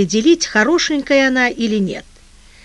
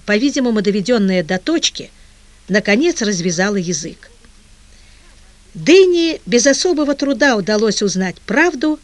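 plain reading voice

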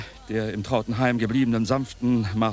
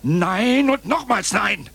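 loud, high protest; outraged denial; 11 semitones higher, 6 dB louder, much slower than usual. Acted. Uncle loudly refusing the widow's claim, outraged. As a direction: refuse with full force.

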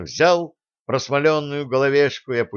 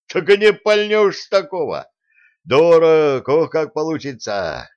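loud, high, hearty exclamation; booming mirth; 6 semitones higher, 6 dB louder than usual. A narrator's reading. Prince ridicules the teacher's little cannon, loudly amused. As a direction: crow it out loud, well pitched up. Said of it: "зычно расхохотался Дмитрий Иванович".

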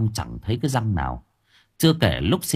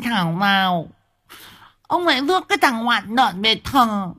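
exasperated wife; 14 semitones higher than usual. A narrator's reading. Wife laments the wrong grave, high and wailing. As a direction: moan at him like a fed-up wife. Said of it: "thở hắt ra lắp bắp".

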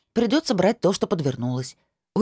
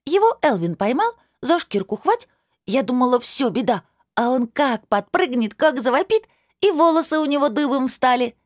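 put-on high breathless little girl storytelling; a child gushing her story; excited child chatter; 8 semitones higher than usual.

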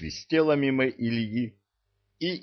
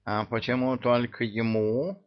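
indignant; 3 semitones higher than usual. Acted. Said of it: "возмутился с подоконника Мурзик".

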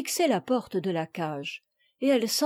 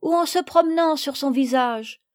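put-on lightly raised girl's voice; a hint of an eager little girl reporting; young girl explaining; 6 semitones higher, 3 dB louder than usual. Little girl telling Madame why Madeleine is tired, eager.

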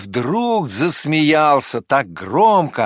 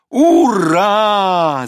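higher, loud group cheer; everyone shouting; 9 semitones higher, 8 dB louder than usual. Acted. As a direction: whoop it up with the crowd. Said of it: "закричали все звери".

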